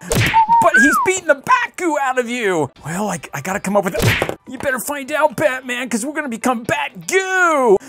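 high, loud, worried sidekick voice; panicked sidekick. Pretend. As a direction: urge Batman to hurry before they become goo, high and loud. Robin sidekick panics in play.

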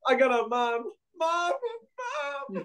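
very high, imitated pleading; mock plea for mom. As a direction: mimic a helpless victim calling out in falsetto. Mimicking a victim crying for mom.

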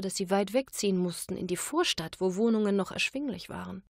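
plain reading voice

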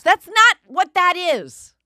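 mock Rory's exasperated outburst; exasperated husband. Rory fed up with her maybes, imitated as a loud exasperated outburst. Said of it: "And he goes".

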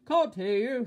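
very loud, high shopkeeper shout; booming holler; thundering shout. A narrator's reading. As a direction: thunder it at the customer. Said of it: "thundered the grocer".

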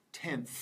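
"tenth" said alone with an audible final th; final th demo. Shown as a demonstration. Teacher models next word for learners, "tenth" with final th. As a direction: model "tenth", letting the last th hiss out. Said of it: "see it at the end of the word".

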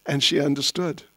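ordinary talk

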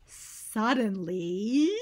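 slow drawn-out suspenseful word. Shown as a demonstration. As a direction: stretch it out to build suspense. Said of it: "hold your breath".

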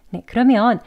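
ordinary talk, nothing marked

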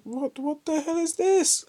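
high, slow, baffled squeal; surprised squeak. Real, not acted. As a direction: jump way up in pitch in disbelief. Sudden confusion at picked item.

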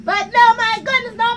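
ordinary talk, nothing marked

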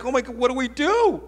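hammed-up high-pitched panic; panicked screaming. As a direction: cry out in mock alarm. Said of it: "they started screaming".

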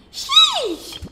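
sheesh yelled high and loud; excited shout. Real, not acted. Streamer thrilled by the name, shouting. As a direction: blurt it out in delight.

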